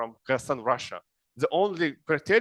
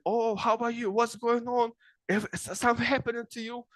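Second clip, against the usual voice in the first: much higher, quick, worried voice; concerned American; 8 semitones higher than usual. Retold or imitated. Lecturer imitating Americans worried by sad faces, higher and fast. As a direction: rush the questions out in high alarm. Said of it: "And they said".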